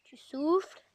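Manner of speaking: whispered breathing reminder; calm yourself in a whisper; whisper to self. Real, not acted. Alone in the forest, whispering himself calm. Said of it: "On se calme".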